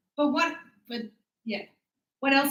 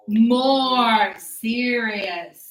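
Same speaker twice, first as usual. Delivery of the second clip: More serious stretched very slowly; very slow model reading; 4 dB louder, much slower than usual. Shown as a demonstration.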